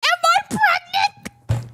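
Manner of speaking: slow, very high-pitched squeal. Real, not acted. Squealing through laughter at absurd quotes.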